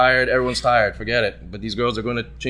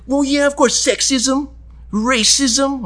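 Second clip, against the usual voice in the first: high, self-righteous mock listing; hammed-up bar-goer impression; imitated indignant woman; 12 semitones higher, much slower than usual. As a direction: reel off grievances in her high voice. Imitating her list of reasons.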